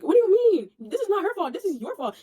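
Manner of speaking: high-pitched, incredulous correction; exasperated, pitch shooting up; indignant retort. Retold or imitated. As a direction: set him straight in disbelief. Acted retort, blaming him.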